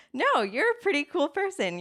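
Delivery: high, emphatic reassurance; supportive inner voice. Pretend. Coach voicing the kind voice in one's head, brightly encouraging. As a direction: pitch it up like a cheerleading friend.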